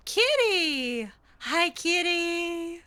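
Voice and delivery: high, drawn-out greeting to a cat; gasp, then coo a slow hello to the kitty; delighted gasp at kitty. Real, not acted. Player spots a kitty in the game, cooing delighted hello.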